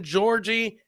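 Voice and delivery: ordinary talk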